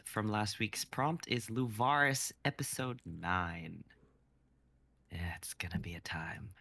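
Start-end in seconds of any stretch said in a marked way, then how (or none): 5.1-6.5 whispered aside; hushed, fast aside to the group; wry whisper. Real, not acted. Aside about long script.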